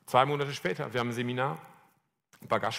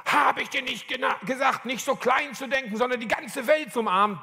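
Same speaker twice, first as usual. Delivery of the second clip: high, forceful rebuke; hard-hitting admonishment, pitched way up; rock-hard voice; 8 semitones higher than usual. Retold or imitated. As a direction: deliver it as a hard, sudden command. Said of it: "mit einer knallharten Stimme".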